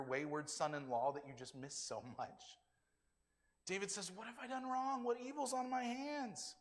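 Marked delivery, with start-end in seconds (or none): 4.1-6.6 high, sustained plea; protesting innocence. Acted. David asks Saul what he has done, protesting plea. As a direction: plead your case in a raised pitch.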